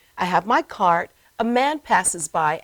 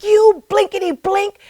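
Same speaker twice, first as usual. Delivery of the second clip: much higher, louder put-on voice of a man swearing; angry man cursing; 11 semitones higher, 9 dB louder than usual. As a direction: spit out a censored curse as the rude man. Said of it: "he's like".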